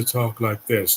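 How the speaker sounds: lightly put-on dull British accent; mock British accent. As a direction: imitate a boring British way of talking. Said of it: "They're so boring".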